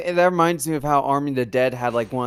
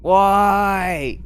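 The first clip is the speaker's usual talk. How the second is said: loud, raised, drawn-out cry of why; exasperated wail; 6 semitones higher, 5 dB louder than usual. Pretend. Co-host hears about the destroyed Smallville set, exasperated wail. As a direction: wail it out in mock despair.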